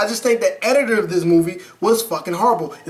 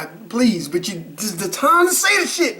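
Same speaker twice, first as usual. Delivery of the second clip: normal talking voice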